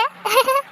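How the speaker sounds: high-pitched giggle; sudden laugh. Acted. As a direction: break into a high little laugh. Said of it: "என்ன உடனே சிரிக்கிற".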